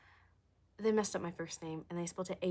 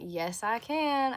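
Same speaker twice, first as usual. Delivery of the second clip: drawn-out Southern country accent, pitched up; 4 semitones higher, 4 dB louder, much slower than usual. Shown as a demonstration. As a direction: stretch it slowly in a country twang. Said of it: "Can I do a country accent?".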